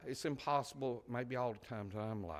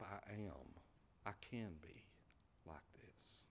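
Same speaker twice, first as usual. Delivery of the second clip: much softer, dropping to a whisper; fading whisper; 4 semitones lower, 16 dB quieter than usual. Acted. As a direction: let it fade into a hushed whisper.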